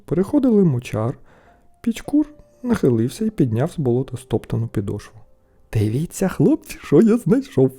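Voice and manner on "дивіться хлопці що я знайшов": rising to a high call; excited exclamation. A narrator's reading. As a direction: get the lads to look. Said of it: "вигукнув він".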